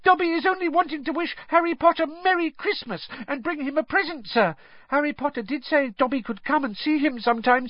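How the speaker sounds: high, squeaky eager elf voice; a timid, eager little elf; squeaky house-elf. A narrator's reading. Narrator voices house-elf Dobby.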